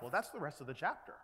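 ordinary talk

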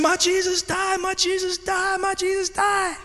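very high mock scream, held back in volume; imitated child screaming. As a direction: mimic a child's scream heard from down the hallway. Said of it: "And he's screaming".

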